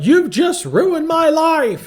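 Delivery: put-on high, loud, slow teen wail; mock upset teen yelling at her aunt; retold yell. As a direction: wail it like a furious teenager. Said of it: "she's yelling at her aunt".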